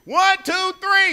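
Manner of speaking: shouted count-in, pitched high; counting loud. Shown as a demonstration. Pastor counting the church in to yell, loudly. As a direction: hype the crowd with a big count. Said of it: "on the count of three".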